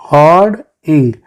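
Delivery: हॉर्ड, इंग as two separate beats; syllable-by-syllable हॉर्ड … इंग; split into syllables. Shown as a demonstration. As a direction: pause clearly between हॉर्ड and इंग. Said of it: "हॉर्ड को अलग और इंग को अलग".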